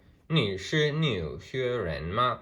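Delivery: "你是纽约人吗" read slowly with each Mandarin tone clear; slow Mandarin demo. Shown as a demonstration. Teacher repeating the board sentence, slow and clear. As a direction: model "你是纽约人吗" carefully for learners.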